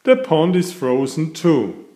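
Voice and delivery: ordinary delivery, nothing marked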